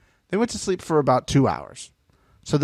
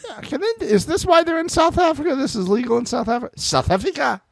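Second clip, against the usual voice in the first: much higher, disbelieving questions; incredulous high pitch; 11 semitones higher than usual. Real, not acted. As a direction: squeak out the disbelief. Incredulous about South Africa.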